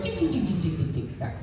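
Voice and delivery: soft imitated rotary dial clicks; phone dial imitation. Shown as a demonstration. Showing how the old phone dialled, soft ding sounds. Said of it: "then it goes".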